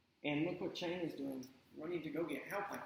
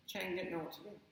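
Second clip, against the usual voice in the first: ordinary delivery, nothing marked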